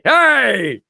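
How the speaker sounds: sudden high, loud hey; attention-grabbing shout. Retold or imitated. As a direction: blurt it out to fill a silence. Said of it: "that's what you do when".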